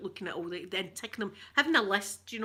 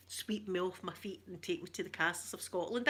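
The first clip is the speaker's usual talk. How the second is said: normal talking voice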